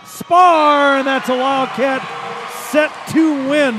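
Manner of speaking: loud, high sportscaster shout; excited winning-point call. Real, not acted. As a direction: belt out the set win. Calls the set-winning point.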